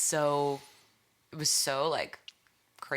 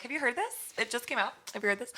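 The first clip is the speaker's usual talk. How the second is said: higher-pitched, eager questions; excited pestering; 9 semitones higher, faster than usual. Retold or imitated. Artist retelling how they told the Uber driver, excitedly. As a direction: act out pushing big news on a stranger. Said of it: "I was, like, yelling and".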